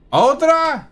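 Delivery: high, loud shriek from another room; a shrill official yelling from inside. Acted. Someone inside the chamber shouts the news, high and loud. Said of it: "You hear from inside the council chamber".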